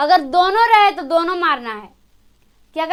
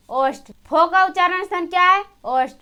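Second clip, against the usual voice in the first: ordinary delivery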